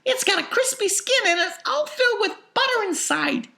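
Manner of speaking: put-on very high, loud excited child; thrilled girl. A narrator's reading. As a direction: rave about the meal, pitched very high. Violet raves over each course.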